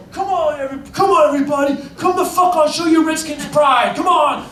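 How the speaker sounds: hammed-up stadium yell to start the wave; drunk fan shouting. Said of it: "And he kept yelling".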